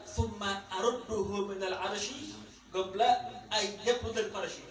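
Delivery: slow Arabic recitation. Acted.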